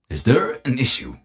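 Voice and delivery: lightly voiced robot, slightly high; Sir Bo's machine voice. Sir Bo turns to Journey, lightly voiced machine. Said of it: "Sir Bo kind of".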